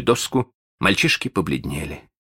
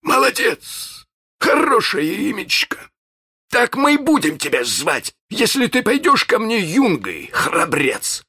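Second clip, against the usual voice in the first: high, theatrical villain praise; pirate captain approving. Acted. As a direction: hail the new recruit in a captain's big voice.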